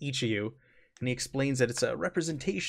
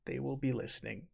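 hushed repeat; 12 dB quieter, faster than usual. Acted. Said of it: "He just repeats".

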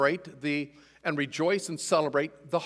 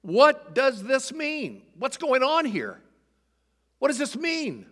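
much higher, slow, bewildered crowd crying out; amazed perplexity; 9 semitones higher, 5 dB louder, slower than usual. Acted. Crowd at Pentecost reacting to the tongues, amazed and perplexed. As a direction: voice the stunned crowd asking what it means. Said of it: "in amazement and great perplexity".

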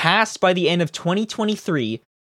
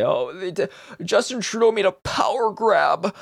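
higher-pitched mock alarm; mock crying outrage; 5 semitones higher than usual. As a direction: imitate a whining political complaint. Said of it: "they're crying and screaming and they say".

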